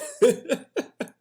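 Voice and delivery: high rising laugh; pleased laugh. Real, not acted. Pleased with his phrasing, high rising laugh. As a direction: laugh at your own cleverness.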